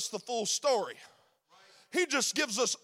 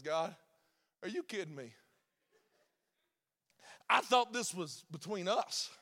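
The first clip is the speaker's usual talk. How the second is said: much softer and lower, grumbling to himself; a put-upon Moses feeling sorry for himself; low, muttered self-pity; sulking Moses; 5 semitones lower, 8 dB quieter than usual. Acted. Moses protests to God.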